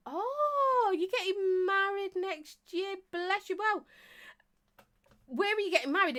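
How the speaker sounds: real high-pitched, stretched-out delight; delighted gushing. Real, not acted. Gushing at viewer's wedding news.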